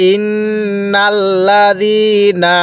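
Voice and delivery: ইন্নাল্লাযীনা with the ন্ন held through the nose, slow; nasal ghunnah. Shown as a demonstration. Teacher shows nasal ghunnah in ইন্নাল্লাযীনা. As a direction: hum the নুন of ইন্নাল্লাযীনা nasally. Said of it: "টান দিবেন না".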